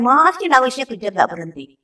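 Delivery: ordinary talk, nothing marked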